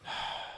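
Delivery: huge breath let out; acting out a long, relieved breath; deep breath. Man retelling his first breath outside, huge deep breath. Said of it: "take a huge deep breath".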